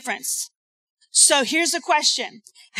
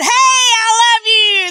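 mock gushing declaration; 8 semitones higher, 7 dB louder than usual. Acted forced declaration.

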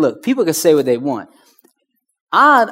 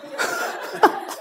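breathy laugh; 6 dB quieter than usual. Real, not acted. Kids watching, embarrassed.

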